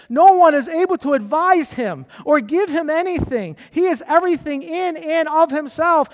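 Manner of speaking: loud proclaiming sermon; preaching. Acted. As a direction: call out to the church. Preaching to the church.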